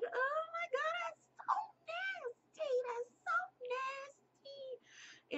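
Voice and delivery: very high squeal of mock disgust; grossed out. Girl reacts to man licking his tongue at her, grossed out. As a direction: squeal it way up high, stretching out the nasty.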